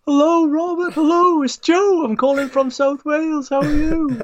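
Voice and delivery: very high, squeaky mock hello; hammed-up impression of an over-keen caller; mimicked frantic greeting. Mimicking co-host's frantic phone greeting.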